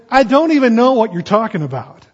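high, loud and exasperated; loud final denial. Acted. Peter's third denial to a stranger, loud and exasperated.

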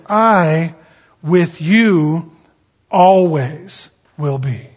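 "I" punched and held, pauses after "I" and "with you"; emphatic Greek word order. Shown as a demonstration. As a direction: set "I", "with you", "always" apart with weight. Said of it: "the way it's written in Greek".

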